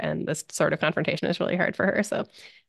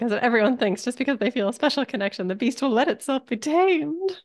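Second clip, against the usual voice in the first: high, theatrical imitation, slowing at the end; hammed-up high-pitched quote; put-on character voice; 7 semitones higher than usual. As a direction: quote it in a lofty high voice, stretching the last words. Voicing a show's grand statement.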